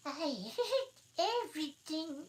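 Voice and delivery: slow, high, sleepy put-on voice; hoarse feeble Dormouse. Dormouse protesting he was awake, slow and feeble. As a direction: drag it out half asleep. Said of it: "in a hoarse, feeble sound".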